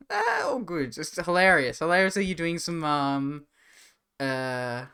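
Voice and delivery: ordinary talk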